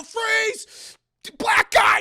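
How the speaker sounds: loud high barked order; hammed-up police shout; mock cop yell. Cops storming the house, loud mock command.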